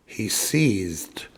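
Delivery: breathy whispered reread. Shown as a demonstration. Rereading the scene for listeners, in a whisper. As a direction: whisper it for drama. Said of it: "I want to read that again".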